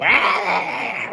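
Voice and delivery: long high mock roar; Tasmanian devil roar. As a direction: imitate a whirling Tasmanian devil yell. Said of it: "She was just a fucking Tasmanian devil".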